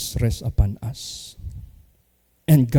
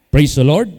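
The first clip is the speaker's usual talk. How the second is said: a big call out, louder; loud praise; 4 semitones higher, 11 dB louder than usual. Real, not acted. Pastor calling the congregation to praise, loud. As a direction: shout the praise to the room. Sermon exclamation.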